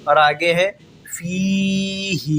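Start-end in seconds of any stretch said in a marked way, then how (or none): 1.2-2.3 فِيهِ with both vowels drawn out long. Shown as a demonstration. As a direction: stretch fee and hee slowly. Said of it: "دونوں کو بھی کھینچیں گے". (فِيهِ دونوں مد کھینچ کر)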